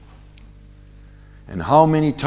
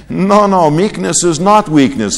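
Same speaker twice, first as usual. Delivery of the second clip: louder, higher, pressing the point; emphatic insistence; 6 semitones higher, 4 dB louder than usual. Real, not acted. Preacher correcting the congregation, louder and higher. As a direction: raise the voice and insist.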